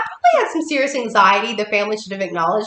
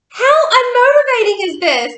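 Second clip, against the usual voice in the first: high, loud, slow incredulous scoff; mock outrage, dragged out; sarcastic disbelief; 13 semitones higher, 5 dB louder than usual. Reacting to the pep talk, loud sarcastic disbelief. Retelling her reaction at fire walk.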